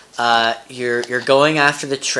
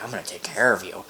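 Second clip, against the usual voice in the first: hushed tender promise; 6 semitones lower, 8 dB quieter than usual. Retold or imitated. Leo voiced tenderly.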